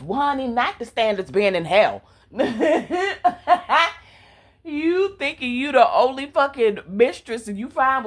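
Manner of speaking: loud, sassy scolding of a character; sassy rant. Real, not acted. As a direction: read her for filth, honey.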